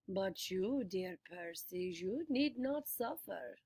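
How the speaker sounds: slow, soft purring voice; comforting purr. A narrator's reading. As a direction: purr to win him over. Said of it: "modulated back to a comforting purr".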